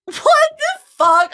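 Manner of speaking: high, loud and slow disbelief; incredulous outburst. Real, not acted. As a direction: wail it in disbelief. Laughing at the casting.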